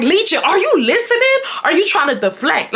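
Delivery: high-pitched, indignant questioning; retold exasperated demand. Angry woman calling out her deflecting ex, exasperated. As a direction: demand his attention, pitched well up. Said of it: "but I'm so angry".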